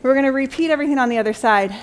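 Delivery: ordinary delivery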